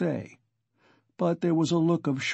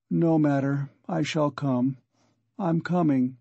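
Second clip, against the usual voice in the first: ordinary delivery, nothing marked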